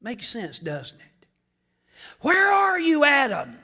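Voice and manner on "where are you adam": loud high call; God calling out. Acted. Preacher voicing God searching, loud call. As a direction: shout it across the garden. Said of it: "God calls Adam".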